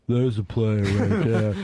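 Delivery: low, slow dad impression; imitating dad's slow, low praise; approving dad voice. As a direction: put on an old-school dad, drawn out and low. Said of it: "the player that your dad would say".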